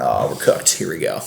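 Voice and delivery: low, quiet doomed groan; a hint of resigned doom; mock dread. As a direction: drop the voice low like all is lost. Called in by managers, dread.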